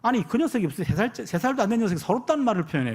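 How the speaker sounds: ordinary delivery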